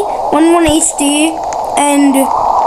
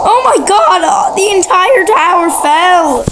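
excited shout; 4 semitones higher than usual. Real, not acted. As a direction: yell it out in shock. Tower collapse shock.

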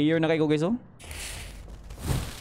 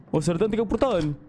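normal talking voice

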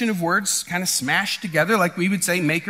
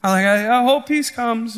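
mock hopeful, higher-pitched voice; passive wishful hoping; 5 semitones higher than usual. Someone sitting on their hands, mock wishful hoping. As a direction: imitate someone idly wishing things improve. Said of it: "just sit on your hands and go".

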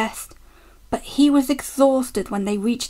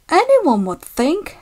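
mock innocence; 6 semitones higher than usual. A narrator's reading. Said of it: "said Douglas innocently".

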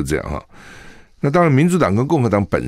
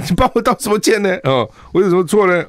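much higher, louder indignant comeback; mock Trump retort; 12 semitones higher, 4 dB louder than usual. Host imagines Trump answering a reporter, high mock indignation. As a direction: play Trump brushing off the apology, high and huffy. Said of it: "川普说".

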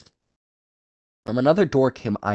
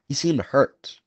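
ordinary delivery, nothing marked

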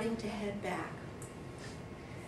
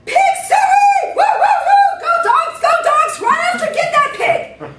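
shrill, very high and loud hollering hog call; 19 dB louder than usual. Retold or imitated. The hunter urging his dogs after the hog, screaming hog call. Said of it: "he jumps up and he goes".

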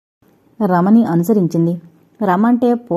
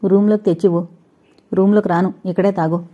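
usual speaking voice

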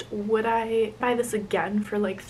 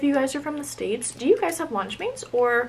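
talking while chewing chocolate; mouth full chewing; 3 semitones higher than usual. Real, not acted. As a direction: hand covering mouth. Seen 1: ate chocolate at -3; hand covers chewing mouth until 1.3.

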